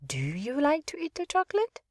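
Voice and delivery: rising question on "chocolate"; question tune. Shown as a demonstration. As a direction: ask it, lifting at "chocolate". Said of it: "this could also be a question".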